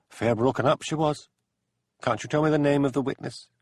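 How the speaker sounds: ordinary delivery, nothing marked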